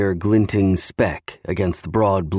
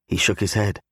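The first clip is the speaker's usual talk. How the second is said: ordinary delivery, nothing marked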